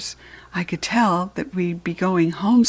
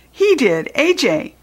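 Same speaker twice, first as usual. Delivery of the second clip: lightly voiced schoolchild, higher pitch; child telling tales; 6 semitones higher than usual. A narrator's reading. Garth tells the teacher who spoke, a hint of high child voice. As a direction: pipe up to point out the culprit.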